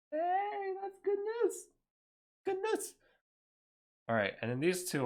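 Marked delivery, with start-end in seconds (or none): 0.1-2.9 much higher, squeaky cartoonish cheer; squeaky joke voice. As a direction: squeak out the good news like a cartoon. Relieved he wasn't banned.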